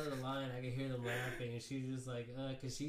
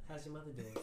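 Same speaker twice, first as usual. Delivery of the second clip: higher mock Boston voice; Boston accent; 4 semitones higher than usual. Texan imitating Boston people, Boston accent. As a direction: put on a joking Boston accent. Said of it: "And it's all, like, Boston".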